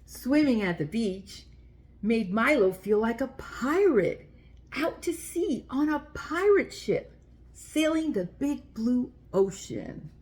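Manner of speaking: put-on gentle read-aloud for little kids; children's story reading. A narrator's reading. Reading a picture book aloud.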